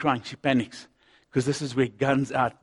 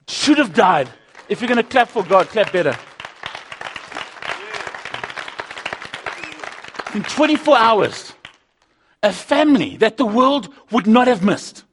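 loud, high rallying cry; preaching at full force; 9 semitones higher, 9 dB louder than usual. Acted. Rallies the congregation.

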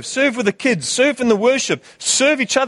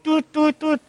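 alarm beeps; 8 semitones higher, slower than usual. Retold or imitated. Imitating alarm clock.

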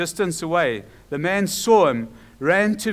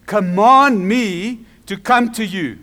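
slow, loud, emphatic request; bold plea; 3 semitones higher, 7 dB louder, slower than usual. Acted. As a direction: call it out deliberately, word by word. Peter's bold request, acted.

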